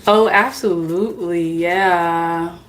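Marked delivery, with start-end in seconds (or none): none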